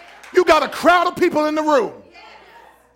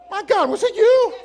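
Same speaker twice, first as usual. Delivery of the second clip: higher, amazed question; 7 semitones higher than usual. Acted. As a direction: turn around in disbelief. Jesus turning to the woman.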